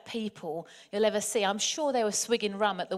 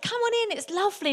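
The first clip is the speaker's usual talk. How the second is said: high, singled-out call from the water; mock cheery call; 11 semitones higher, 3 dB louder than usual. Dan calling his reluctant companion into the sea, mock cheery. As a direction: beckon eagerly in a much higher voice.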